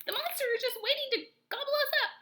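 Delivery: high, fast, panicked shriek. A narrator's reading. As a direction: squeal it out in a panic. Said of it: "shrieked Dig Down".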